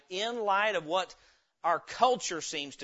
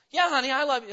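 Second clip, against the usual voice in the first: loud, high, impatient reassurance; frustrated husband; 7 semitones higher, 6 dB louder, faster than usual. Acted. Husband answers wife in an acted quarrel, impatient. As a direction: brush her off with irritated affection. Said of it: "is he frustrated with her".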